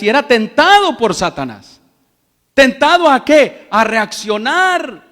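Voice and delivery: loud, driving pulpit delivery, building to the congregation; fiery preaching. Acted. Pastor to the congregation, a loud fiery sermon. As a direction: preach it out loud and high to the church.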